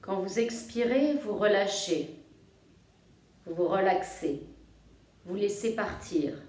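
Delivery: soft, low-key yoga nidra voice with long pauses; guided meditation. Real, not acted. Yoga teacher guiding listeners to feel their breathing, calm meditation voice. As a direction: guide them gently into rest, phrase by phrase.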